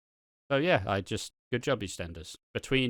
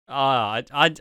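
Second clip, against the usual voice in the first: normal talking voice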